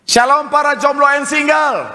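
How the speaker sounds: high-pitched, ringing call to the crowd; rousing greeting. Real, not acted. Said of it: "Saya mau bilang sekali lagi".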